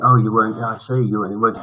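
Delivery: normal talking voice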